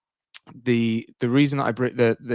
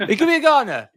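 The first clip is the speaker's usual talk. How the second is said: high mock echo; 13 semitones higher, 6 dB louder, much faster than usual.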